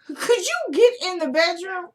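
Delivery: high-pitched, insistent call; exasperated order. Real, not acted. Repeating the bedroom request to Jasmine, exasperated.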